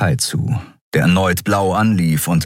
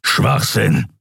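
slightly raised, grumbling dismissal; growling; 4 semitones higher than usual. Acted. Creature rejects captive's story, growling. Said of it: "grollte Kero".

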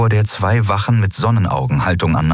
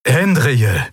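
raised, glad call of a name; delighted greeting; 6 semitones higher than usual. A narrator's reading. Commander beams on seeing her, calling her name gladly. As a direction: greet a missed friend with joy.

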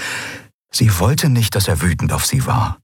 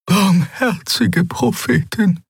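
slow, high hushed prayerful murmur; 13 semitones higher, slower than usual. A narrator's reading. Xea reacting to the death, shocked murmur. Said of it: "murmelte Xea".

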